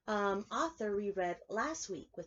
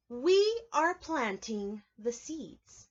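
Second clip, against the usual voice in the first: storybook reading; 3 semitones higher, 7 dB louder, much slower than usual. A narrator's reading. Reading picture book aloud.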